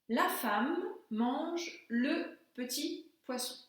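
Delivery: "La femme mange le petit poisson" read slowly and clearly; teacher dictates the exercise sentence, slow and clear; slow dictation. Shown as a demonstration. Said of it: "voyons si vous êtes capable de traduire".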